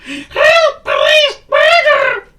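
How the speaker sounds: high, squeaky cries for help; screaming. Acted. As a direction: scream each word in panic. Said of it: "he screamed".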